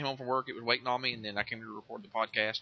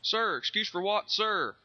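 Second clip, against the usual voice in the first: barked "sir" like a recruit; 9 semitones higher, 4 dB louder, slower than usual. Acted. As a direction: answer like a scared private. Drill sergeant recruit game.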